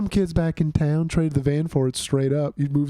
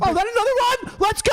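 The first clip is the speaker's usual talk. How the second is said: cheering. Real, not acted. Cheering the home run.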